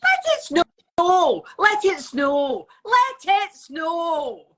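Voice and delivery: slow, loud Scottish chant; Scottish accent. Shown as a demonstration. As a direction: belt it out, Scottish and drawn out. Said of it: "in a Scottish accent".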